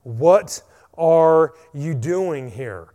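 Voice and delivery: loud, slow and drawn out, calling out; booming voice of God. Acted. Preacher voicing God calling Elijah, loud and slow. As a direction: boom it like the Lord calling down.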